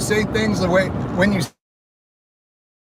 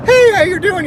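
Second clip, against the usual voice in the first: high, loud sing-song fake greeting; fake friendly greeting; 13 semitones higher, 5 dB louder than usual. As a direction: play the fake polite acquaintance, way up high. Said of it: "they're like".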